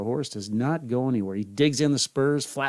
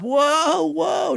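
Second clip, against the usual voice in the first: much higher, louder shouting; desperate shout; 7 dB louder than usual. Acted. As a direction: scream for the horse to stop. Runaway horse near cliff.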